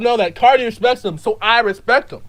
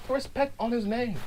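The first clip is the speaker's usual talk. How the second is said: much softer, solemn insistence; quietly insistent; hushed demand; 12 dB quieter than usual. Acted. Same demand repeated, suddenly hushed.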